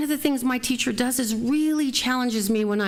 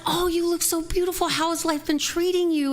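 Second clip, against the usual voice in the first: high, effusive catching-up voice; gushing compliment; 7 semitones higher than usual. Retold or imitated. Manager catching up with a former employee, gushing high. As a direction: play up the delighted small talk, pitched high.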